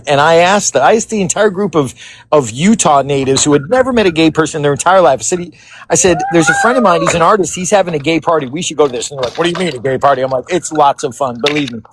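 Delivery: ordinary talk, nothing marked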